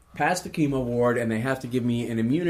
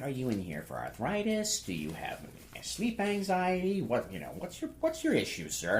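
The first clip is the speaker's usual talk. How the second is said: soft, sing-song clerk voice with big pitch swings; dispensary clerk; 2 semitones higher, 7 dB quieter than usual. Retold or imitated. Clerk screening a marijuana card applicant, soft swooping voice. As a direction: ask softly, swooping up and down. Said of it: "and she's like".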